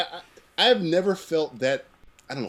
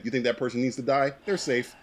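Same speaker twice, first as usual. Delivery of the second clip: usual speaking voice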